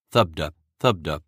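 deep muffled drum beat; low muted thump. A narrator's reading. Heartbeat sound of loom.

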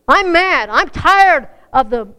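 loud indignant declaration; angry shout. Acted. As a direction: shout it like a fed-up believer. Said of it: "We as Christians gotta say".

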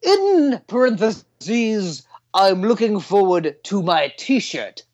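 high, slow put-on English accent. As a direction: read the review in a posh English accent. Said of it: "an English, English accent".

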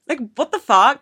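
high, loud exasperated disbelief; incredulous. Real, not acted. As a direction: blurt it out, outraged and baffled. Incredulous at people claiming OCD.

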